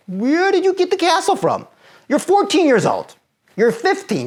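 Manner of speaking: high, loud disbelieving scolding; exasperated rebuke. Real, not acted. Rebuking teen's catastrophising.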